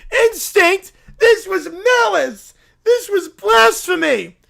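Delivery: indignant cries of injustice; loud wounded outrage; outraged protest. Acted. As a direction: wail at the ruling like a betrayed prophet. Outraged church leader losing case.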